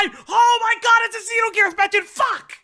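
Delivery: excited shout. Real, not acted. Realizing the Xenogears reference.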